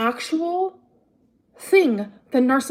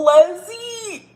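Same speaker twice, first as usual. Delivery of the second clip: higher and louder call of the name; mock exclaim; 6 semitones higher, 5 dB louder than usual. Real, not acted. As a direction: call out the name in fond disbelief.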